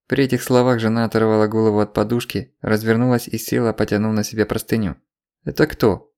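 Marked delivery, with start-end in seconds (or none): none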